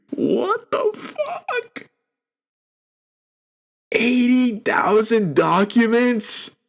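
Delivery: high, slow, drawn-out disbelief; stunned disbelief. Real, not acted. Vlogger reacting to eighty thousand documents, stunned and incredulous. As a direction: let the shock stretch every word up high.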